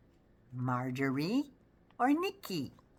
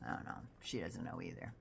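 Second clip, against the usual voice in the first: soft, whispered shrug; whispered aside; 10 semitones lower, 13 dB quieter than usual. Real, not acted. Mocking the congresswoman's ignorance, whispered aside. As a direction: whisper it as a sly aside to viewers.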